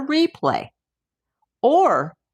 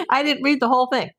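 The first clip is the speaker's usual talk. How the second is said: laughing; 8 semitones higher, 3 dB louder, faster than usual. Real, not acted. Laughs at own misreading.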